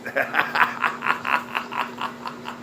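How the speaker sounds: long, soft staccato cackle; drawn-out wheezy chuckle; mocking laugh. Real, not acted. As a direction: laugh on and on at their expense. Laughs at his own mockery.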